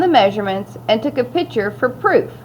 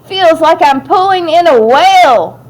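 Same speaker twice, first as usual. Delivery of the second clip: put-on higher, louder boyish holler; excited shout; 6 semitones higher, 5 dB louder than usual. A narrator's reading. Young scout reeling in a big catch, shouting excitedly. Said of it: "he shouted".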